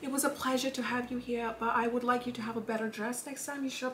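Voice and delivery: soft, genteel mock-British accent. Host dismissing guest from the ball, soft British accent. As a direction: deliver the insult softly in polite British. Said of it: "in a British accent".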